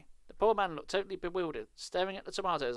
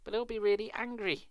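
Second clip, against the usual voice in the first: pleading; 7 semitones higher than usual. A narrator's reading. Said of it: "Evan pleaded".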